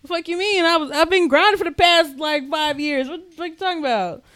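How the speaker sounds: high, loud exasperated rant; indignant. Retold or imitated. Answering him about being grounded, high and indignant. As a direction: protest it, half outraged.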